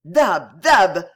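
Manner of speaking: a thief's joyful exclamation; overjoyed cry. Acted. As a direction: cry out Dab in sheer happiness. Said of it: "s'écria Lapouraille au comble du bonheur".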